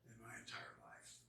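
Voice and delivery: low soft whisper; quiet whisper of the revelation; hushed whisper. Retold or imitated. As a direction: drop to a hush in awe.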